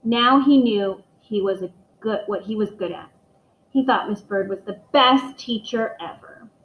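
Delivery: ordinary delivery, nothing marked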